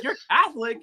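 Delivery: high-pitched incredulous echo; retold disbelief. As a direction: replay your shocked reaction with the voice jumping up.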